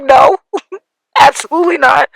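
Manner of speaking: half laugh, half sob; laughing through tears. Real, not acted. Retelling her reply to her brother, laughing through tears. Retold reply while crying.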